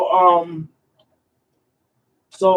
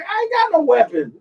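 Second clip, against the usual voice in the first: high, crying mock plea; pleading cry; 9 semitones higher than usual. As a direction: cry it out high, like he can't believe it. Accused man pleads with police.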